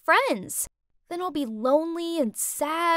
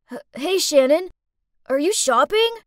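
louder, stammering, rising; nervous spluttering; 3 semitones higher, 8 dB louder than usual. Acted. Dorky boy nervously greeting the girl he likes, spluttering. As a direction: stutter the greeting, flustered. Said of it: "spluttered out".

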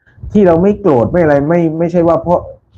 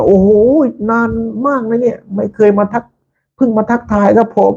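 excited exclaim; 9 semitones higher than usual. Retold or imitated. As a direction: imitate a host thrilled to be greeted. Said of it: "ช่างตั้มร้องเสียงดังเลย".

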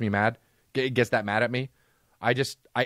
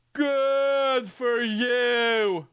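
very high, drawn-out mock rant voice; 12 semitones higher, much slower than usual. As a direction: stretch it out in a high, mocking imitation. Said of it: "When he does that".

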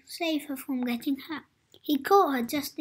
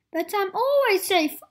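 higher, louder protest; arguing; 5 semitones higher, 7 dB louder than usual. Acted. Mister Adventurous defending himself, arguing. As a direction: push back indignantly. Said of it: "argued Mister Adventurous".

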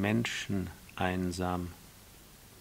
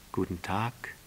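softer reply with rising end; subdued storybook roses' hello; roses greeting; 2 semitones lower, 4 dB quieter than usual. Acted. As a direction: answer gently, lifting the last word. Roses answer the prince.

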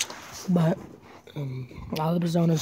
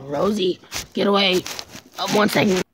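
real shout at dog; 2 semitones higher, 7 dB louder than usual. Real, not acted. Shooing the dog off.

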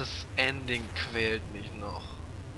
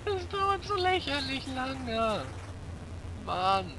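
mock whine; 14 semitones higher, slower than usual. Whiny complaint at slow ending.

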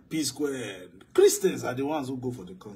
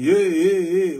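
loud, high shouted hey hey; mock crowd shout; 3 semitones higher, 10 dB louder, slower than usual. Preacher mocking the crowd's outrage, loud shout. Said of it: "people are shouting".

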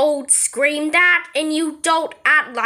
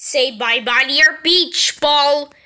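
ordinary delivery

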